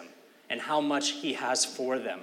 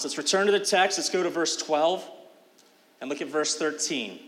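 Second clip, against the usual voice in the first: normal talking voice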